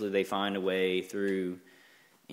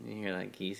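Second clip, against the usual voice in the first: normal talking voice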